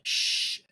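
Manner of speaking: a breathy unvoiced hush standing in for a swear; biting back a curse on the podcast, just a hush; censored curse. Real, not acted. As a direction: cut off the swear word with a hiss. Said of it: "I won't say the full word".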